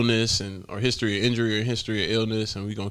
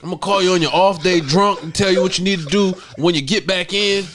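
mock boss voice; 7 semitones higher, 7 dB louder than usual. Mocking the boss's pitch.

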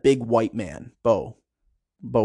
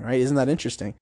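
ordinary delivery

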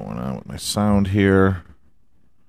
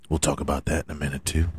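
fast, soft and low throwaway; quick mumble; 5 semitones lower, 7 dB quieter, much faster than usual. Shown as a demonstration. Promising the NBA talk, quick mumble. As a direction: rush it under your breath. Repeats line two ways.

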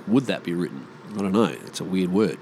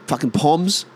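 ordinary talk, nothing marked

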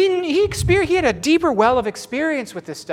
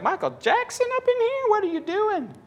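higher, incredulous jab; mocking disbelief. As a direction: poke fun at the dancer. Mocking the worship leader.

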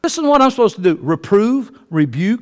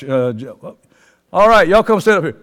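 usual speaking voice

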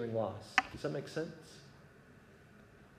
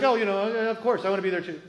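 high, loud, overeager agreement; feigned eagerness; 7 semitones higher, 8 dB louder, faster than usual. Retold or imitated. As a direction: pipe up too keenly, covering reluctance. Feigning willingness to his kids.

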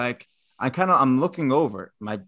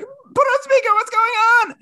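very high, loud frantic mock squeal; Mallory impression; 7 dB louder than usual. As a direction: squeal it urgently, imitating a panicked partner. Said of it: "It's a great Mallory impression".

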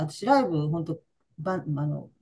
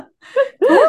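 loud laugh; 14 dB louder than usual. Real, not acted. Burst of laughter at old father.

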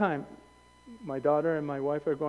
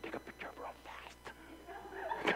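slow, whispered through a laugh; hushed urgent whisper; 19 dB quieter, much slower than usual. Retold or imitated. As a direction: whisper so the parrot won't fly. Tourists with parrot.

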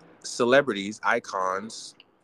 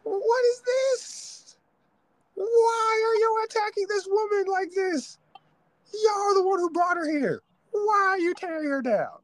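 outraged disbelief. Retold or imitated. Host defending [name] to her critics, high outraged squeal. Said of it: "And I'm like".

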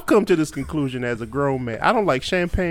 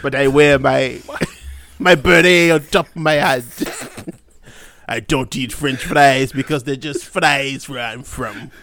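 hammed-up French accent, halting with chuckles; 3 dB louder than usual. Shown as a demonstration. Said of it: "give me your best French accent".

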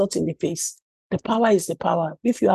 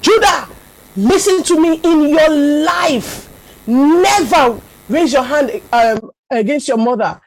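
stern scolding; 7 semitones higher, 7 dB louder than usual. Retold or imitated. Mother scolding her son who slapped her, stern and raised. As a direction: lay down the law to your son.

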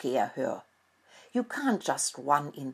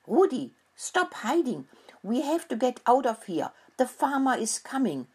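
higher, pressing warning cry; urgent shout; 8 semitones higher than usual. A narrator's reading. Mouse child urges the hidden rat to flee, calling urgently. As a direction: hurry him out, calling high. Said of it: "Mocolino shouted".

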